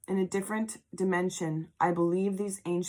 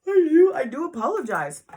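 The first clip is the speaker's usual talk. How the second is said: through a yawn; 9 semitones higher, 9 dB louder than usual. Real, not acted. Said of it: "for the yawning".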